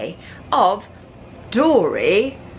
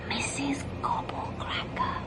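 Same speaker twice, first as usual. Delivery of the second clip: whisper; 6 semitones higher, 12 dB quieter than usual. A narrator's reading. Luke shows Rascal how to say her name, whispering. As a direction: breathe the dreaded name in a whisper. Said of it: "You have to whisper when you say her name like this".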